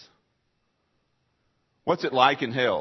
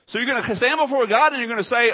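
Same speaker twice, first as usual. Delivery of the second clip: preaching shout; 10 semitones higher, 6 dB louder than usual. Real, not acted.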